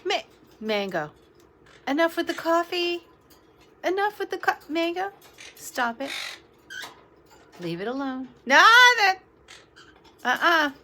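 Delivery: loud high scold at the bird. Real, not acted. Owner scolding her parrot over the coffee, loud. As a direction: snap at her to drop the coffee.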